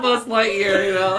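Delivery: normal talking voice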